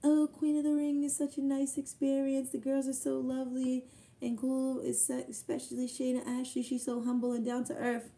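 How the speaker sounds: high, sugary reading of her post; imitating her gushing post; mock sweet post. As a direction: read it back sweetly, mocking her.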